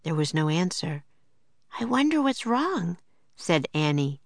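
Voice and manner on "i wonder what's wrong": put-on high, worried girl's voice; girl wondering aloud; young girl voice. A narrator's reading. Annie worried.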